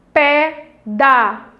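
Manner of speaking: slow four-part split; syllable by syllable. Shown as a demonstration. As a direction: count out the syllables with small gaps. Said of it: "quatro sílabas".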